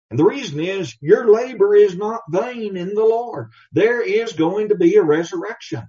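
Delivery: preaching with force; rising preaching emphasis. Real, not acted. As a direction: lift the voice to land the point. Preacher drives home the point.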